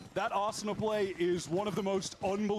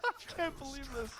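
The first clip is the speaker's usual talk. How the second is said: out of breath; 2 semitones lower, 9 dB louder than usual. Real, not acted. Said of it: "I'm trying to catch my breath".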